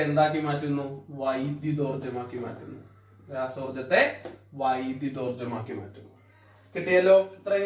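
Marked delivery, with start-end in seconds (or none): none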